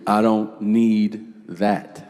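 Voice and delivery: put-on slow sulky kid. Brother refuses bread.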